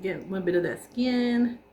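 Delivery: normal talking voice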